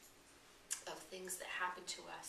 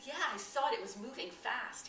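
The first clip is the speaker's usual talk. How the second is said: insistent; 4 semitones higher, 8 dB louder than usual. Retold or imitated. Frightened man insists on snake.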